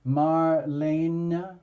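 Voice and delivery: Marlene said slowly as mar-LAY-nuh; drawn-out three syllables. Shown as a demonstration. Host checking a client's name, Marlene as slow mar-LAY-nuh. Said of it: "am I saying the name correctly".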